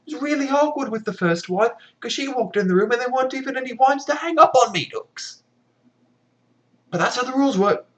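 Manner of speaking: a squeaky villain ranting about his rules; hammed-up high villain scolding his wife; exasperated Bluebeard. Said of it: "looks at her and is like".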